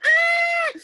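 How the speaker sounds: high shrieking mock scream; a short horror-movie scream. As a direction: shriek like the startled victim. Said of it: "and she's like".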